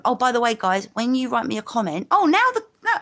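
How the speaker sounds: ordinary talk, nothing marked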